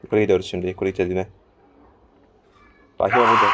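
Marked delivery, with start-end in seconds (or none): 3.0-3.5 sudden loud alarmed shout. Real, not acted. Gamer spots the nun and shouts in alarm. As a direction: blurt it out loud on spotting her. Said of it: "çok sık bağırıyorum be rahibe".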